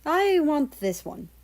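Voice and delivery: put-on choosing the cat in a Russian accent; decided, with a heavy Russian accent. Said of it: "spoke with a heavy Russian accent".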